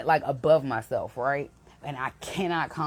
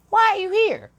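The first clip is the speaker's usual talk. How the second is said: high, loud, incredulous demand; indignant challenge; 8 dB louder than usual. Retold or imitated. Demanding why the intruding colleague came in, indignant and loud. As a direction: throw the question out in disbelief.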